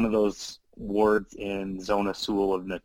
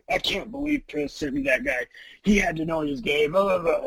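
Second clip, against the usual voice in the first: higher, indignant retold rant; fuming companion; 11 semitones higher than usual. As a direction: play the outraged roommate, a bit hammed-up. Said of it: "Mateus is just fuming".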